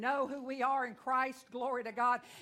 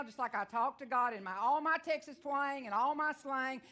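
normal talking voice

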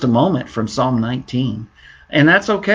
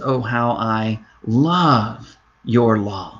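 tender psalm quote; 3 semitones lower, much slower than usual. Acted. Psalmist's words, slowed, softened.

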